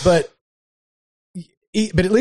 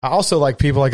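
ordinary talk

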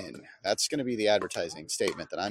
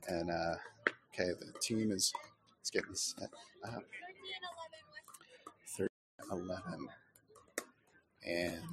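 hushed courtside commentary; near-whisper so the players won't hear; golf announcer whisper; 11 dB quieter than usual. Real, not acted. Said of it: "like a golf announcer 'cause I'm trying to whisper".

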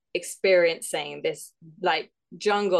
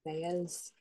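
put-on quiet, tentative Welsh accent; attempted Welsh accent; 11 dB quieter than usual. Contestant starting the monologue reading, tentative Welsh accent. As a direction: try a hesitant Welsh accent. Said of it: "read it in a Welsh accent".

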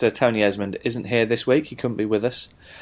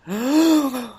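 mock scared gasp. After "we may even get scared.

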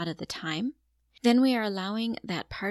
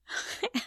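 delighted laugh; 13 semitones higher, 3 dB quieter than usual. Real, not acted. Delighted after child's success.

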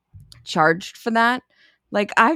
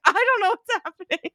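high whimpering voice; mock crying panic; 13 semitones higher than usual. Quoting a panicked bridesmaid, high mock whimper. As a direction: whimper it in fake distress. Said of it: "it's like".

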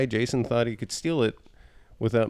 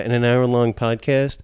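ordinary delivery

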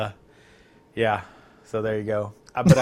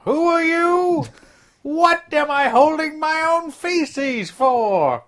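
high confused shouting ape; 7 semitones higher than usual. Pretend.

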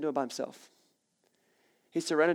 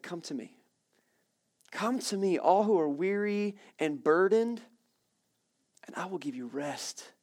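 tender scripture quote; 3 semitones higher, slower than usual. Acted. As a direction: draw them in gently, slowly. Quoting Jesus tenderly.

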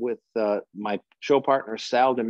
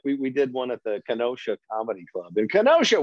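ordinary talk, nothing marked

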